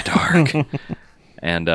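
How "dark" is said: whispered through a laugh. Pretend. Podcaster echoing the dark gag, laughing whisper. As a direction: giggle then breathe it spookily.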